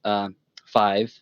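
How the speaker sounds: ordinary delivery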